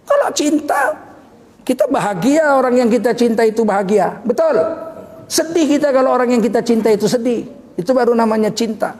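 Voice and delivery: preacher at full force; fiery preaching. Acted. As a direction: drive the point home to the congregation.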